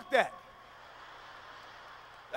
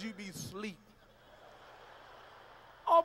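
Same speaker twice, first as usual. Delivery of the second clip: ordinary talk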